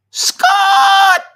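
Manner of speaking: very high, drawn-out shout of a name; imitated shout. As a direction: holler it like a sound clip, long and high. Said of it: "Scott's got that goes".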